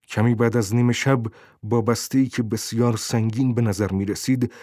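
ordinary delivery, nothing marked

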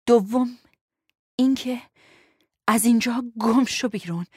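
halting, hurting dismissal; groaning. A narrator's reading. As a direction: groan the order in short bursts. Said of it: "نالید".

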